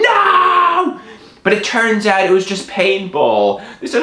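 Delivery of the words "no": long high mock scream. Storyteller under fire from hoodlums, high scream. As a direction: drag out a high horrified cry. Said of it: "and I screamed".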